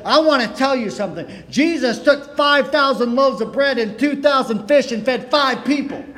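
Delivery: excited preacher. Retold or imitated. New preacher fired up at his congregation, rushing excitedly. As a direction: blurt it out in a hurry, all fired up. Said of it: "he got excited".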